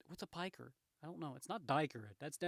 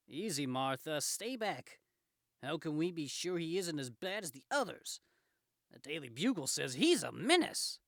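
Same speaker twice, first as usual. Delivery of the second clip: lightly acted suspicious man; wary bystander; 4 semitones higher, 8 dB louder, slower than usual. Man telling Martha to stay back, suspicious. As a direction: warn her off with rising doubt.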